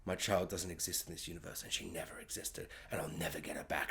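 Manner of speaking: hushed, unvoiced despair; whispered grief. Allison's hopeless realisation, whispered. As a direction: whisper it like a broken mother. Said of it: "But then it's like".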